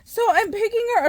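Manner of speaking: ordinary delivery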